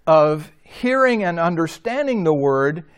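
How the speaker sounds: ordinary delivery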